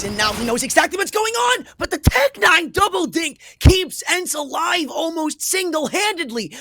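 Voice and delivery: loud, high caster yell; excited shout. Real, not acted. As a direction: shout the play out. Clutch play called.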